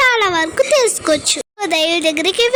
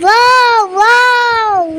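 high, loud delighted wow; excited wow; 4 semitones higher, 4 dB louder, much slower than usual. Real, not acted. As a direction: gasp of delight at the dancing peacock. Excited at seeing peacock. (ఉత్సాహంగా wow)

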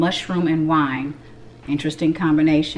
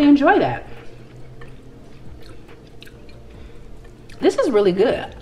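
talking while chewing; chewing food; 3 semitones higher than usual. Real, not acted. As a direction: mouth partly full. Seen 0: Bites brown piece at -7; chews through the labelled words, bites again 8.9.

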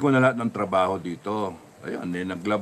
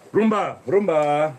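louder, higher drawn-out call; real call to a pet, raised and stretched; 6 semitones higher, 6 dB louder, slower than usual. Real, not acted. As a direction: call the dog by name, slowly. Calls out to the dog Rumba.

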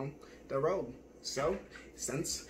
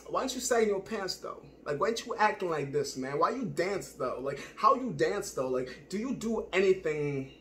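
hammed-up nosy challenge in a put-on voice; 3 semitones higher, 3 dB louder than usual. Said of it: "types that people put down like".

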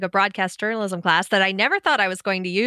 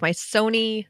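normal talking voice

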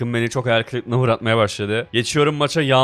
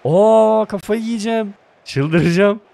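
high, loud exasperated outburst; going crazy; 8 semitones higher, 5 dB louder, slower than usual. Real, not acted. Gamer losing patience with the team, exasperated. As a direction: lose it over the miss.

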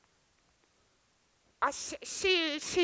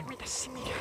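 hushed whisper to a plant; conspiratorial whisper to a houseplant; 5 semitones lower, 12 dB quieter than usual. Acted. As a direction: lean in and whisper it. Secret aside to the plant.

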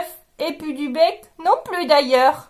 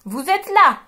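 haughty king barking orders, higher and louder; bossy lion; 6 semitones higher, 4 dB louder than usual. Acted. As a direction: order the servants about. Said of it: "leur dit". (le lion hautain donne des ordres)

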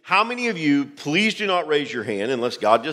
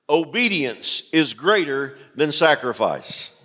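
normal talking voice

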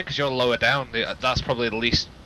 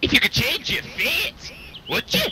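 slow, high mock Scottish accent quoting the Brave meme; playful imitation of a Scottish accent, drawn out; 12 semitones higher than usual. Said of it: "has no one heard that before? That meme?".

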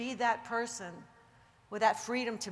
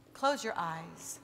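soothing meditation guide over soft music; guided meditation; 6 semitones lower, 7 dB quieter than usual. Acted. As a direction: lull them gently into calm. Leads a relaxation exercise.

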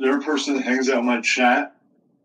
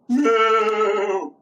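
higher put-on moo; cow moo; 8 semitones higher, 3 dB louder than usual. Cow puppet Jamie announcing herself with a moo. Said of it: "That's Jamie".

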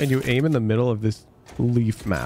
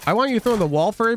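raised pitch, scolding player; half-joking anger, pitch lifted; playful scolding at the player; mock-mad coach; 8 semitones higher than usual. Real, not acted. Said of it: "now I'm fucking mad".

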